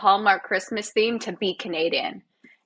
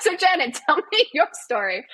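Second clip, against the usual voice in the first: high, laughing mock-interviewer voice; hammed-up host's prompt, pitched up; deflecting interviewer; 7 semitones higher than usual. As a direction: playfully turn the question back on the host. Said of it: "So then I'll be like".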